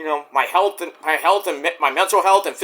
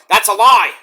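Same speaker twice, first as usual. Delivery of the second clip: louder, punched denial on lie; firm denial; 3 semitones higher, 6 dB louder than usual. Real, not acted. Vlogger shooting down critics, loud flat denial. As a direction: shut it down hard.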